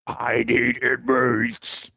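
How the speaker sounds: low, slow put-on voice; deep slow voice. Reading a caption in a voice.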